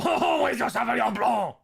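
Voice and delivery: loud, high mock French accent; hammed-up French wine order. Walking into the wine store, ordering in a loud, high mock French accent. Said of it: "I go".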